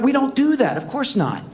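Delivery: ordinary delivery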